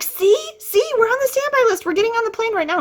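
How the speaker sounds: high, gloating see see; triumphant teen. Retold or imitated. Teen tells her dad they got called, high and triumphant. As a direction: rub it in that you were right.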